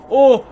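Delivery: short, high, loud yelp; sudden real yelp of fright; startled shriek. Real, not acted. Startled by the game.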